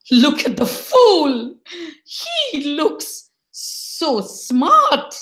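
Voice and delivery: high, drawn-out mock wail; acted aggrieved complaint, slowing right down; envious indignation. Kurmai eyeing Budu in his lovely shawl, envious and indignant.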